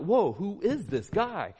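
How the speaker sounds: slow, drawn-out wondering question; playing an astonished onlooker; acted amazement. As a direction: stretch the words in awe. Acts out astonished disciples.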